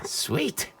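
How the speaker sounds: ordinary talk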